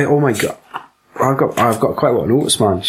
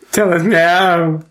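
slow, raised-pitch demand; mock demand; 4 semitones higher, much slower than usual. Interrogating the captured bounty hunter, mock demand. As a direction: draw out the order, pitched up.

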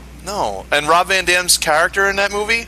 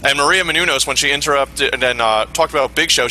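ordinary delivery

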